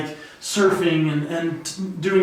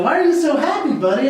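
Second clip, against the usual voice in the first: put-on excited high voice to a toddler; cheerful dad asking; 8 semitones higher, 4 dB louder than usual. Dad quoting himself to his son, cheerful and high. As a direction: brighten up to ask your little boy. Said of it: "And I go".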